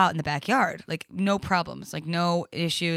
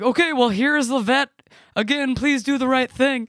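louder, higher voice of pleading brother; imitating brother; 6 semitones higher, 5 dB louder than usual. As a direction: play the brother handing over the phone, insistent. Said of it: "where my brother's like".

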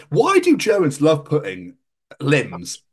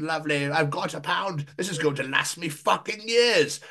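ordinary delivery

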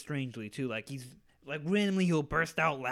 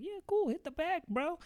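high, put-on egging-on voice; fake-friendly tease, pitched way up; high mocking voice; 14 semitones higher than usual. Friends egging the kid to hit the bag, in a high mock voice.

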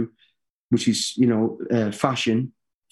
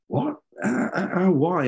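retold stammering surprise, pitched up; baffled reaction; 6 semitones higher, slower than usual. Speaker reacting to old man's praise, baffled. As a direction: act out being lightly taken aback.

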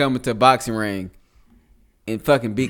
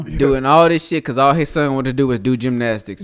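ordinary talk

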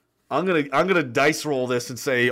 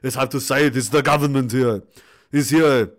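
put-on lower-pitched Arnold Schwarzenegger Austrian accent; 4 semitones lower than usual. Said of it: "say his name in the Arnold accent".

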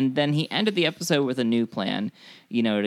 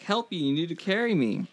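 mock wounded plea; 5 semitones higher, 3 dB quieter than usual. Acts out wounded boy's plea.

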